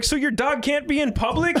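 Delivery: high-pitched gotcha question; retold incredulous retort. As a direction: pounce on the excuse in mock disbelief. Retelling own triumphant retort.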